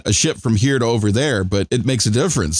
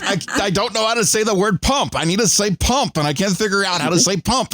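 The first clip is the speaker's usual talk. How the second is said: higher, frantic mock panic; lightly voiced flustered translator; mock flustered Hoshi; 10 semitones higher than usual. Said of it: "she's like".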